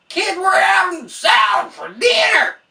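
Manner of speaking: high, loud hammed-up shout to the kid; mock parent shout. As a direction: yell at the kid like an overexcited parent. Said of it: "And you're like".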